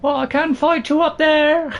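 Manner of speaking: high, loud put-on warrior call; Eowyn. Lady Eowyn challenging the dragon above, high and loud. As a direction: shout up a challenge in a hammed-up voice. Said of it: "My best Eowyn voice".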